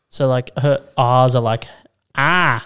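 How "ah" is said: drawn-out Boston "ah"; Boston accent. Shown as a demonstration. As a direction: show the Boston R as "ah". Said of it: "a thick Boston accent".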